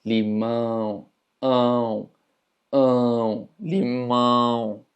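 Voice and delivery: drawn-out limão, the "ão" buzzing in the nose; nasal exagerado. Shown as a demonstration. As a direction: make the nose vibrate on ão in limão. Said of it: "pronunciar algo de manera nasal". (limão alargado, con «ão» muy nasal)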